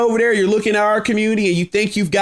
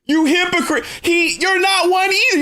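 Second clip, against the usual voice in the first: high, laughing accusation; incredulous laughing jab; 10 semitones higher than usual. Real, not acted. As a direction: laugh it out while calling him a hypocrite.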